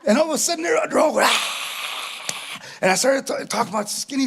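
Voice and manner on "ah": breathy voiceless crowd "ahh"; imitated crowd roar. Audience reacting to his jokes, imitated breathy roar. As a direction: mimic a distant cheering audience. Imitates women roaring with laughter.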